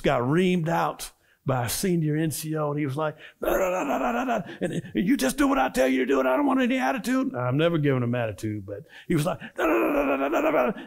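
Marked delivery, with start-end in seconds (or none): 3.4-7.3 high, fast ranting sergeant, laughing; mock sergeant rant. Sergeant yelling imitated. 9.4-10.8 high blah-blah rant; mock sergeant rant.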